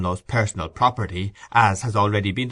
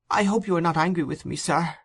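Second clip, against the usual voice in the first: put-on high, quick pleading boy; beseeching; 8 semitones higher than usual. A narrator's reading. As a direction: plead anxiously in a small, high voice. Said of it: "raising his eyes beseechingly".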